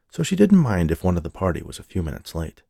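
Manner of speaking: usual speaking voice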